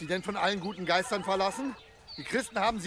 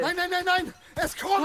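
loud, higher panicked shout; panicked cry; 8 semitones higher, 5 dB louder than usual. Acted. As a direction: cry out in terror as it comes. The monster approaches. (panischer, lauter Schrei)

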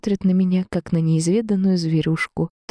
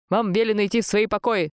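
strict guard's command, pitched up; stern order; 5 semitones higher than usual. A narrator's reading. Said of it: "строго проговорил один из мужчин".

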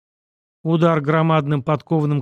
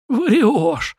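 pained gasp, higher and louder; 8 semitones higher, 3 dB louder than usual. A narrator's reading. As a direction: blurt it out on a gasp of pain. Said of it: "охнув от боли, выдохнул стрелок".

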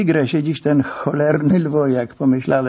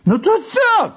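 angry. Acted. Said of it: "Wściekł się zastępca".

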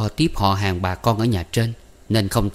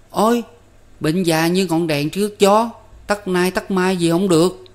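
put-on lightly raised young boy voice; a cheeky kid showing off, higher; boy Năm; 10 semitones higher than usual. A narrator's reading. Little brother Năm lecturing Tư Cầu, higher boyish voice.

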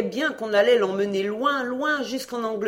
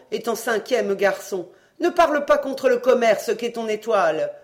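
stern proud mother; 6 semitones higher, 4 dB louder than usual. A narrator's reading. Mother lecturing son.

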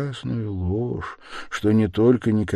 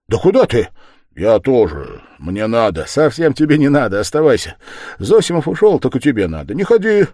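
agitated insistence; 7 semitones higher, 9 dB louder than usual. Acted. Insisting a friend must stay.

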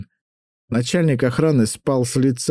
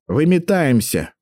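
barking; 6 semitones higher, slower than usual. A narrator's reading. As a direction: bark it out slowly. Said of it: "гаркнул на них".

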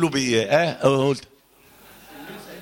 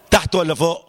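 ordinary talk

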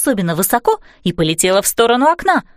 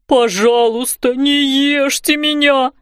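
slow, put-on pleading whine; begging; 2 semitones higher, slower than usual. A narrator's reading. Terrified roofer to supposed monster, begging. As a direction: draw it out, imploring for your life. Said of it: "взмолился мужчина".

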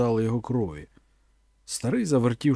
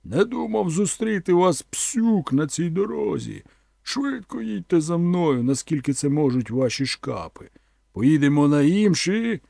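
higher, slowed hero's voice calling his men to follow; Conan's voice, urging; 5 semitones higher than usual. A narrator's reading. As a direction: lift the voice and hurry the riders on.

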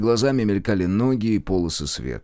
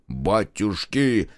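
startled exclamation; much slower than usual. A narrator's reading. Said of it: "вскинулся Марков".

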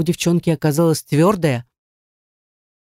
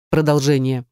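ordinary delivery

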